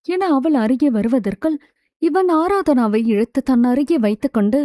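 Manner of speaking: usual speaking voice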